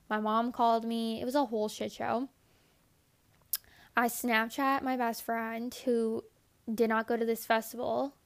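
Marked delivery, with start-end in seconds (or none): none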